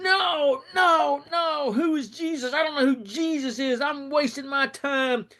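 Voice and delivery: high, frantic shouted panic at the top of the voice; mock screaming. Retired professor screaming while running circles in Burger King, high and frantic. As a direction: act out a professor shrieking in alarm as he runs. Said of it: "started screaming and at the top of his".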